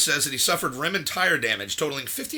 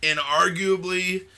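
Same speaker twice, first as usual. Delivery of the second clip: usual speaking voice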